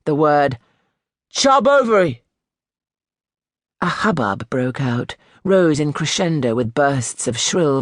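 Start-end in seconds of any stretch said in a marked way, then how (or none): 1.3-2.2 very high, loud shout as if calling someone; the new boy yells his own name to the class; shouted at top of voice. A narrator's reading. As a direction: blurt the name out all at once. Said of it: "shouted at the top of his voice".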